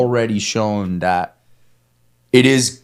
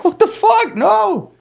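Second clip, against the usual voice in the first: incredulous outburst; slower than usual. Real, not acted.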